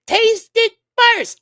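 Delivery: a mother scolding, stretched out; imitating mama. A mother telling her son to taste the food first, high and insisting.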